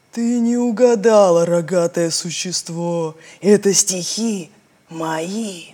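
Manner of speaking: a hint of a slow stone voice; slow stony voice. The island tells the cow the verses are its own, slow stony voice. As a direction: answer slow and proud.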